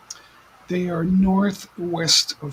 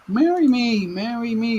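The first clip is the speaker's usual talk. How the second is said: playful plea; 6 semitones higher, 5 dB louder than usual. Pretend.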